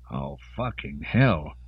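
low, slow, pained mutter; pained groan. Acted. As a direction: groan it out through the pain. Hungover man wakes in pain.

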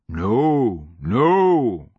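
put-on old-man crooned denial; mad old man. A narrator's reading. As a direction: wave the question away with swelling noes. Old sergeant dismissing question.

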